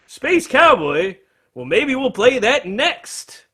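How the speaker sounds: high, slow, drawn-out stage voice; imitated frontman. Frontman answering a shouted request, playful drawn-out imitation. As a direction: imitate a singer musing aloud to the crowd. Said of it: "hearing him say".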